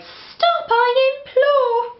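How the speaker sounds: put-on high, fretful old lady lament; old lady at her wits' end; old lady crying out. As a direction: wail it as a flustered old lady. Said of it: "The little old lady cried".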